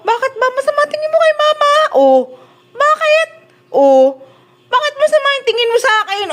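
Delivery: high-pitched playful scolding; mock indignation. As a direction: demand an answer from the pet in mock offence, pitched high. Mock scolding the glaring dog. (pabirong panunumbat, mataas ang boses)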